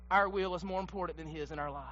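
soft, low and weighty; sudden hush. Acted. Preacher lamenting to the church, hushed. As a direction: let it fall quiet and grave. Preacher falls quiet, disappointed.

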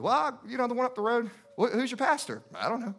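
comic imitation of evasive answers; clueless churchgoer. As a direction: play someone caught out and vague. Acted evasive answers about church.

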